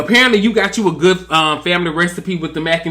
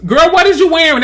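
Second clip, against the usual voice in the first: much higher and louder mock outrage; mock scandalized; 10 semitones higher, 5 dB louder than usual. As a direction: act shocked at the outfit. Retold reaction to outfit.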